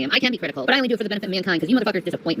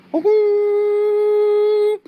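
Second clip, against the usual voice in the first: long, high, louder woo-hoo like a horn; mock shofar blast; 9 semitones higher, 4 dB louder than usual. Blowing the shofar at viewers with a held high hoot. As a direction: imitate a shofar with the voice.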